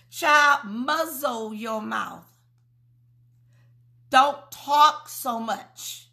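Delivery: slow, drawn-out scolding; slow teasing rebuke; slow warning. Real, not acted. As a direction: stretch each word like a warning. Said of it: "slow emphatic admonition".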